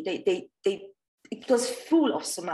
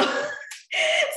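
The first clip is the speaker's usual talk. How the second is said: a quick high burst of laughter; short laugh. Real, not acted. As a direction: laugh before the joke. Laughs before telling a joke.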